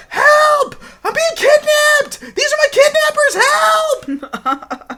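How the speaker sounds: very high, drawn-out squealed shout; mock yelling for help. Kid alerting the crowd to kidnappers, hammed-up yelling. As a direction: wail it slowly like a kid raising the alarm. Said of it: "yelling out".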